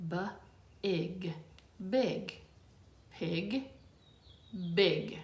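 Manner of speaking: "B", "ig" blended into "big", contrasted with "pig"; sounding out. Shown as a demonstration. Teacher showing rhyme for kids, clear sounding out. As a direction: blend sounds into the rhyming word. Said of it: "change the P sound to B".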